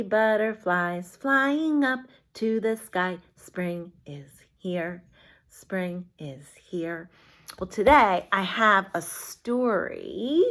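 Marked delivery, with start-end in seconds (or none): 3.4-5.0 soft slow whisper; hushed finger-play whisper. Shown as a demonstration. Whispered repeat of chant. 5.5-7.1 soft slow whisper; breathy hushed chant. Shown as a demonstration.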